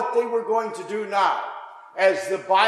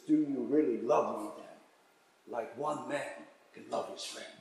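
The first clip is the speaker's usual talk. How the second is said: gentle and hushed; 7 semitones lower, 12 dB quieter than usual. Acted. Jesus meets Peter gently.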